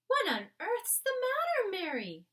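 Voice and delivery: a queen upset after a bad night. The Queen tells her maid Mary about the dream, regal and shaken. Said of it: "the famous voice was saying".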